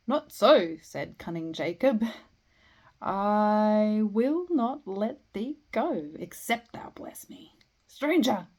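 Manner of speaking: theatrical recital, loud opening words; big swells and drops in the verse; dramatic poetry reading. Acted. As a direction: declaim the defiant lines, drawing them out. Acts out poem's dialogue.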